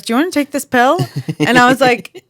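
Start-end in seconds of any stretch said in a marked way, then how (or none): 0.0-1.0 higher, voiced-apart party guy; party guy offering. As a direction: casually offer the pill. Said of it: "one guy goes".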